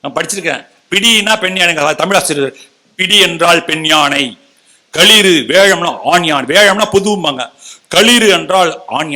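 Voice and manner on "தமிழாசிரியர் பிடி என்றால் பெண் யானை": ordinary delivery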